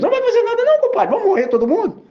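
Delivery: very high, loud frantic complaint; panicked yell. Retold or imitated. Frightened disciples complaining to Jesus, high and loud panic. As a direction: panic that everyone will die.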